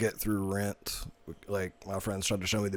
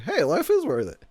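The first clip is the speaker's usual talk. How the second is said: high, loud mock-cheery line; comic ad lib; 9 semitones higher, 6 dB louder than usual. Friend imagines hugging her back, comic and high. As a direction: toss it out high and loud as a joke.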